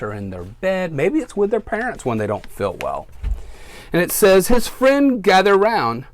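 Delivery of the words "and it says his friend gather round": storybook read-aloud for little listeners; reading to children. A narrator's reading. Teacher reads to her class, storytime voice.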